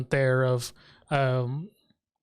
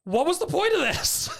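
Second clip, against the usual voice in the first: exasperated; 13 semitones higher than usual. Real, not acted. Exasperated viewer complaint.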